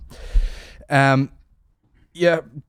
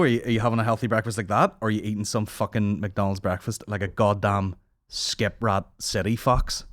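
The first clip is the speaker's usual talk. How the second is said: ordinary talk, nothing marked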